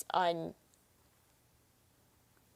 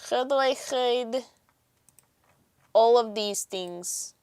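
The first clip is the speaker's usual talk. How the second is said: put-on Russian with throaty, guttural H; hammy fake Russian, drawn out; bad Russian accent; 3 semitones higher, slower than usual. Streamer playing to the chat, bad Russian accent. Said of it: "It's a really bad Russian accent".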